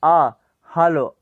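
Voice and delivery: आ, हालो drawn out slowly; slow teacher demo of आ, हालो. Shown as a demonstration. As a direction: stretch the आ in हालो. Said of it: "क्योंकि उसमें आ है".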